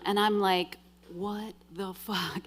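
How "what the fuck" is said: retold disbelief. Woman reacting to yet another man's plan, stunned. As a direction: say it slowly under your breath, stunned.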